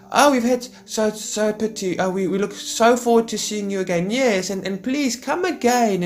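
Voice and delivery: very high, sugary mock farewell; high-pitched gushing goodbye. Visitors leaving the neighbours' house, high mock gushing. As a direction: gush insincerely at a high pitch as you leave. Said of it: "when you hear the high-pitched voices".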